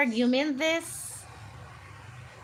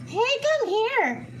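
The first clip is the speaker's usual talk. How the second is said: coaxing the bird; 8 semitones higher than usual. Real, not acted. Calling the cockatiel over.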